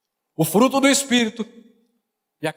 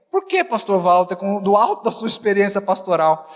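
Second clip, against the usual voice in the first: normal talking voice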